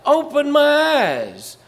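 slow, high and loud plea to the Lord; slow loud prayer; fervent plea. Retold or imitated. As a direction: stretch the prayer out, begging. Repeats the prayer.

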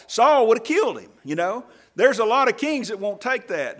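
loud, driving sermon climb; fiery pulpit preaching; preaching at full force. Acted. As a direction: preach it hard to the congregation.

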